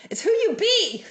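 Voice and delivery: high, laughing take-off of a mentor's catchphrase; imitating Cindy Williams. Coach imitating a mentor's phrasing, high and laughing. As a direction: mimic her signature line with a grin. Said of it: "If you've ever heard Cindy Williams speak".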